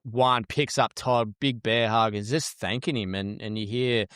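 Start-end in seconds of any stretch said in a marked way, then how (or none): none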